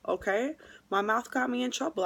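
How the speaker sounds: ordinary delivery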